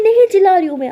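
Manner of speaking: very loud, high, defensive; shouted denial. Acted. Maya denies shouting at her partner, shouting. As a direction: yell it while denying any yelling. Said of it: "चिल्ला क्यों रही हो मुझ पे".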